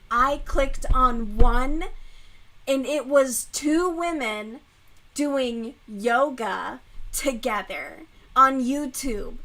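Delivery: loud, slow, indignant; mad rant. Real, not acted. Ranting to viewers about the brother's subscriptions, loud and angry. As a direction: lean into the outrage. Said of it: "Ooh, I was so mad".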